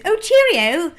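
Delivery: high, loud mock-English farewell; hammed-up cheery English accent; crap English accent. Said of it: "with a really crap English accent".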